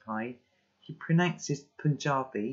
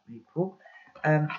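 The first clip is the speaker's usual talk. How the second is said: plain reading voice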